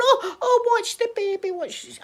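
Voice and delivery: high, loud cry of alarm fading to a mutter; mock-panicked gran protecting the baby; alarmed warning. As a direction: mum warning Sophie not to sit on the doll. Mum warns Sophie off doll.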